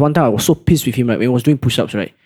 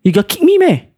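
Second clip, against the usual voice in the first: much higher, puzzled "meh?"; genuinely confused, high; surprised kid; 11 semitones higher, 3 dB louder than usual. Retold or imitated. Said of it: "He asked me that".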